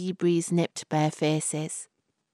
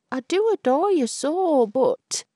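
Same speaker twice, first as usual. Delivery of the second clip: high, louder doting protest; adoring young man; 10 semitones higher, 4 dB louder than usual. Acted. Albert tells Florrie he adores her, high and hesitant. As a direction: plead tenderly then falter.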